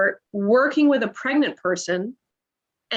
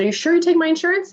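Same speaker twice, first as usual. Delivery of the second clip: anxious double-check; 8 semitones higher, much faster than usual. Retold or imitated. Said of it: "And I said".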